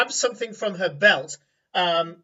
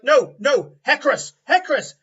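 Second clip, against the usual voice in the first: higher, louder plea to stop; frantic shout; 7 semitones higher, 9 dB louder than usual. Acted. Farmer stepping in front of the charging warrior, frantic shout. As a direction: cry out to halt her charge. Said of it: "and he's there like".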